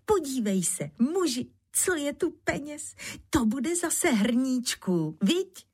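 high joyful calling; radostné volání. A narrator's reading. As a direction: call out delightedly to your husband. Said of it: "hned volala radostně". (radostně, vysokým hlasem)

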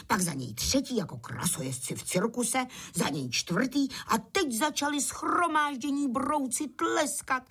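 ordinary delivery

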